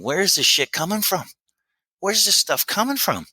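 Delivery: mock the guys' astonished outburst; amazed disbelief. Sponsees at step three asking where this comes from, amazed.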